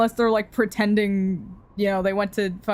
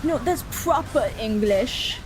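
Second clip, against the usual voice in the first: mock proper British; slower than usual. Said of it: "they'll be like".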